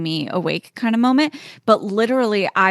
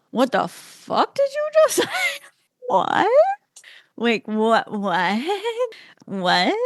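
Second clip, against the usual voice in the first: stunned disbelief; 9 semitones higher, slower than usual. Retold or imitated. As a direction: shriek it in laughing disbelief. Shocked reaction to his text.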